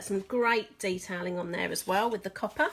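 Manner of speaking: ordinary delivery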